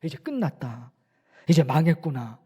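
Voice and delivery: low, subdued voice of giving up; mock losing heart, dropping soft and low; acted discouragement. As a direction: imitate someone sinking into despair. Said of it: "낙심이 되니까 어떻게 돼요?".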